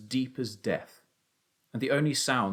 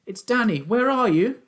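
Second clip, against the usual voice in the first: loud, very high urgent call; shouting; 12 semitones higher, 7 dB louder than usual. A narrator's reading. Son heard a faint voice and answers, shouting. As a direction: yell high to find someone. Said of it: "I shouted".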